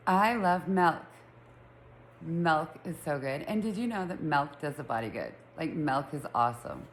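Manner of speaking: "milk" with an open vowel, like "melk"; own regional pronunciation. Shown as a demonstration. Showing listeners how they say it, "milk" as "melk". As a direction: say "milk" as "melk", rhyming with "elk". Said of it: "He says I say it M A L K".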